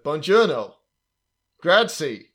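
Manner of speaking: Brad Pitt-style bad Italian in an American accent; mock an American making no effort at Italian; Italian words with American vowels. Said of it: "He keeps the American accent".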